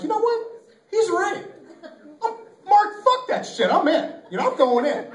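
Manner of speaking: high, loud burst of mock determination; acted-out psyching himself up; fired-up resolve. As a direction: talk yourself into it, voice jumping up. Acting out his sudden resolve.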